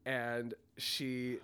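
normal talking voice

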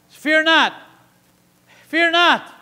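loud, high, ringing exhortation; preaching at full force. Real, not acted. Preacher urging the church not to fear, full force. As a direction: drive it into the congregation.

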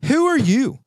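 high, loud, indignant shouting; hammed-up furious questioning; mock outrage. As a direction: demand to know who they are. Ranting at imagined person.

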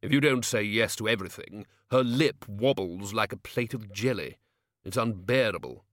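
ordinary delivery

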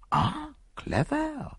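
soft, slow admiring murmur; appreciative murmur. Acted. Poirot admiring the woman's trick, soft slow murmur. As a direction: murmur it, impressed. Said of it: "murmured Poirot appreciatively".

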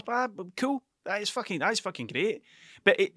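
ordinary talk, nothing marked

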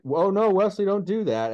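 high, ineffectual gentle scolding; mock calm parent. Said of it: "trying to say that in a calm voice".

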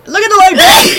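loud call out; shouting. Real, not acted. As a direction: urge them loudly. Trying to trigger a sneeze.

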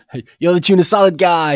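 loud, high mock Italian waiter; Italian accent. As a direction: ham up an Italian accent, calling out across the room. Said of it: "in an Italian accent".

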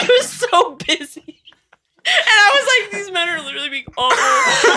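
half laughing, half crying despair; mock-sobbing complaint; laughing exasperation. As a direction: laugh through the frustration. Retelling stressful busy shift.

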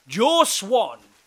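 loud, high-pitched hail; calling out. A narrator's reading. Stranger hailing Joe across the camp, calling out loudly. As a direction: call the name across a distance. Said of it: "a voice called out".

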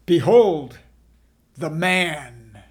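loud proclamation on behold; proclaiming. A narrator's reading. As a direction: announce it to the crowd. Pilate presents Jesus to crowd.

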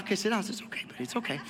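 soft whisper to a pet; soothing a scared dog. Real, not acted. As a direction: hush and calm the dog. Calming the dog.

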